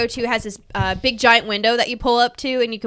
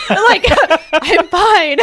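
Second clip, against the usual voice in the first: laughing through the words, louder; 6 semitones higher, 5 dB louder, much slower than usual. Retold or imitated. Retelling the drive-thru chat to friends, laughing. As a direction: giggle out a reassurance.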